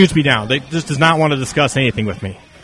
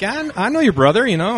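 stunned; 7 semitones higher than usual. Retold or imitated. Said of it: "I was like".

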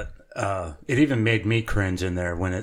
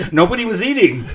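high, loud, laughing exclamation; joking disbelief; 10 semitones higher, 6 dB louder than usual. Real, not acted. Joking exclamation about endless dishes.

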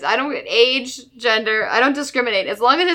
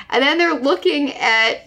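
usual speaking voice

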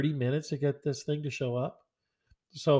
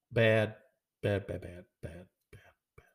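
bad repeated, sinking lower and softer until it dies away; fading repeated bad; 6 semitones lower, 4 dB quieter than usual. Real, not acted. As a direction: let each bad fade lower and quieter. Repeated verdict on food.

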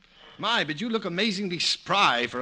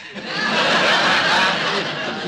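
chuckle; 3 semitones higher, 8 dB louder than usual. Acted. Nick laughs at his own joke.

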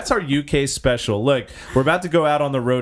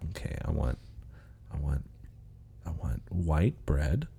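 whispering; 8 semitones lower, 11 dB quieter than usual. Pretend. Whispered sandwich order.

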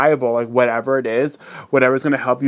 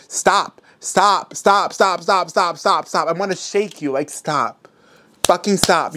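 high, urgent repeated stops; exasperated pleading; 5 semitones higher, slower than usual. Real, not acted. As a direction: beg them to stop, half shouting. Pleading with viewers to stop wavering.

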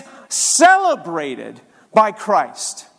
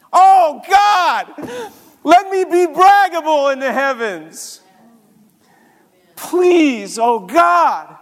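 rising, louder pleading prayer to God; fervent prayer; 6 semitones higher, 4 dB louder than usual. Real, not acted. Preacher praying aloud to God for braggable love, fervent. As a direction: cry out to God, higher and louder.

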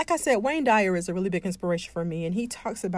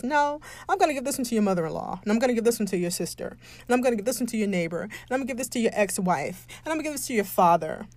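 hurried doctor; 2 semitones higher, much faster than usual. Pretend. Doctor voiced in a story.

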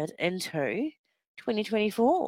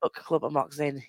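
normal talking voice

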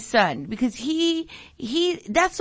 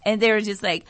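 ordinary talk

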